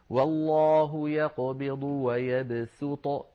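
slow recitation, ويبسط with a light plain sīn; recited with sīn. Shown as a demonstration. Teacher demonstrates reading with sīn, slow and careful. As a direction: recite ويبسط slowly with a clear thin s. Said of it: "نقرأها بالسين".

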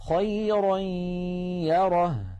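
خيرا يره chanted very slowly, tanween merged into the ya with ghunna held; tajweed merging with nasalization; slow Quranic recitation. Shown as a demonstration. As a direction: stretch the nasal hum into يره. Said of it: "ما مثال التنوين؟".

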